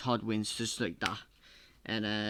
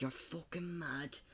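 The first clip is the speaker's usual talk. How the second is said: quiet; 3 semitones higher, 10 dB quieter than usual. Acted. Shocked aside.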